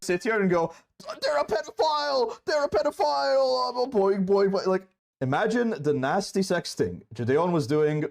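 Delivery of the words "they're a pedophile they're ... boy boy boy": shrill mocking imitation, pitched high; mocking shriek. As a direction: squeal it like a hysterical accuser. Mocking Gideon's accusation.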